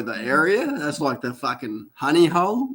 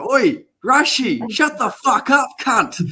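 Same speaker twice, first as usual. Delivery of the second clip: higher, yelled across the forest; calling out; 7 semitones higher, slower than usual. Retold or imitated. Calling to a distant mate in the forest, mock shout. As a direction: call out to a mate far off in the trees. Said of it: "And I'm like".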